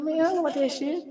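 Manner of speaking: put-on high, sulky kid voice; high dismissive voice. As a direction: sulk it out high. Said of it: "I'm like".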